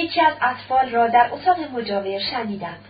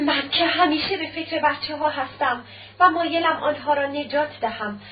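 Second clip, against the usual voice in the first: ordinary delivery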